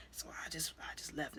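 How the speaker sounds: soft whispered telling; hushed confession. Retold or imitated. Son telling his friend his past, in a whisper. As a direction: confide it quietly.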